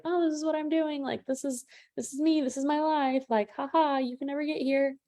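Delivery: high, smug showing-off voice with a mock laugh; hammed-up bragger posting "look at me" content; exaggerated show-off. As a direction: brag like the person who wants you jealous. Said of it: "It's a bit of an exaggeration".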